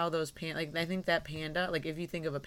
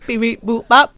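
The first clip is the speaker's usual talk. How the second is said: loud, higher robot beeps; toy robot voice; 6 semitones higher, 19 dB louder than usual. Pretend. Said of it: "I miss Robot [name]".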